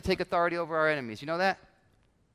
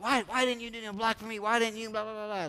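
mock-angry huddle complaint, pitched up; angry teammate; 4 semitones higher, 3 dB quieter than usual. Teammate blaming another in the huddle, mock angry. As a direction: whine the blame at a teammate, trailing into blah-blah. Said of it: "angry at teammates".

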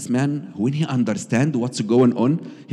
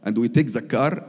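ordinary delivery

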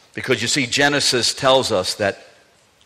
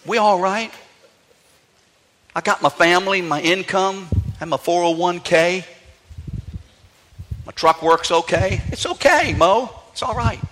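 much higher, breezy carefree voice; complacent people; 6 semitones higher than usual. Retold or imitated. People before the flood brushing off danger, higher and breezy. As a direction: sound cheerfully unbothered. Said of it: "How was it?".

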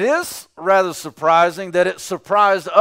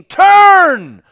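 full-voiced pleading shout, much higher and louder; shouting and screaming; 13 semitones higher, 11 dB louder than usual. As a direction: scream it out as a warning to turn. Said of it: "is to only shout and scream".

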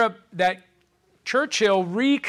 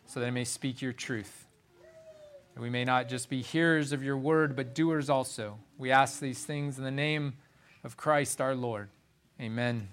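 hushed, reverent prayer voice, softer and lower; 6 semitones lower, 8 dB quieter than usual. Acted. Pastor praying over the congregation before preaching, hushed and reverent. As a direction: pray it quietly, head bowed.

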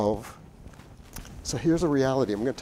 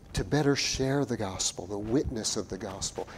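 ordinary talk

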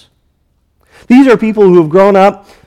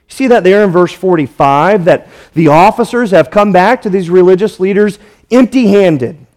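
usual speaking voice

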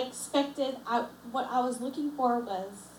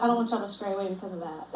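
ordinary talk

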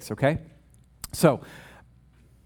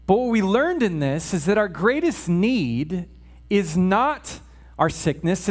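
ordinary delivery

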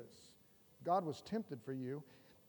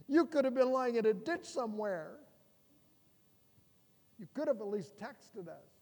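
higher, louder mock-scolding parent; nagging mom; 5 semitones higher, 7 dB louder than usual. Parent scolding a twelve-year-old, hammed-up nagging mom. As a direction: fret like a frantic mom. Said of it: "You know the tone".

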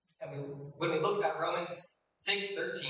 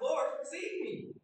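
high, drawn-out plea; calling out for help; 10 semitones higher, much slower than usual. Acted. Preacher voices sinking Peter calling to Jesus, calling out for help. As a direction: cry out slowly, pitched well up. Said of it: "So he calls out".

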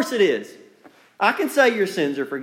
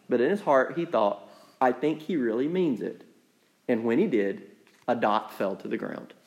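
quiet, lower bedtime-story voice; storybook reading, lower and softer; 6 semitones lower, 4 dB quieter than usual. Acted. Pastor reading the woodcarver story to listeners, hushed and low. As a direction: ease down and read it gently. Reads children's book aloud.

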